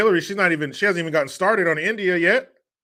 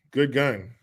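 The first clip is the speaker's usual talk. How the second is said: ordinary talk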